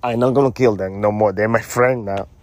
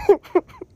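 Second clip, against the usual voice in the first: squealing laugh; helpless shrieking laugh; high shrieking laugh; 3 dB louder than usual. Real, not acted. Laughing fit.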